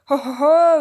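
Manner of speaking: loud jeering chuckle; mocking chuckle. A narrator's reading. The ape jeers at the beasts with a loud chuckle. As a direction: chuckle to taunt them. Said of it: "he chuckled".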